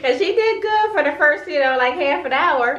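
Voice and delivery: fond laugh while telling. Real, not acted. Laughs recalling her restless child.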